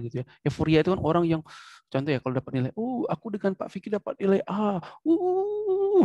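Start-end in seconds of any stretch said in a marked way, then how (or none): 2.7-6.0 high-pitched, over-elated cheer; mock euphoria. Shown as a demonstration. Teacher acts student thrilled by a grade, mock euphoria. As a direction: build into a high drawn-out whoop. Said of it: "euforiknya tuh luar biasa".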